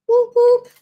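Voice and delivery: high, loud whoop whoop; squeaky little celebration cheer. Real, not acted. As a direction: cheer like at a game. Cheering to herself.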